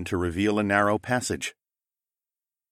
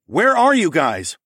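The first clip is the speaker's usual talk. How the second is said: loud, slow, high call; loud searching call; 11 semitones higher, 8 dB louder, slower than usual. Acted. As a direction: shout to locate them. Calling down the corridor.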